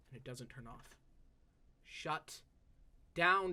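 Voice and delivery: ordinary delivery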